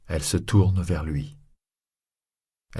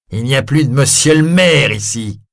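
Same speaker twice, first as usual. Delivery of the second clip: loud, higher, contemptuous; harsh triumphant shout; 6 semitones higher, 7 dB louder than usual. Acted. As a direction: bark it with bared teeth. Javert seizing the mayor.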